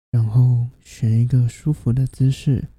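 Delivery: usual speaking voice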